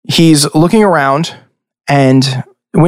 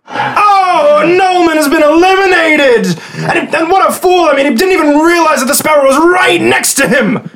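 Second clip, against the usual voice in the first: booming high announcer calling the elimination; excited arena commentator; 11 semitones higher than usual. Acted. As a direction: shout it to the crowd like a sports announcer.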